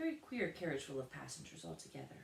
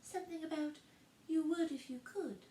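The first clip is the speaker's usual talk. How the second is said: very high tiny voice; little voice; 12 semitones higher, 3 dB louder than usual. A narrator's reading. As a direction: pipe up teasingly. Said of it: "the little voice".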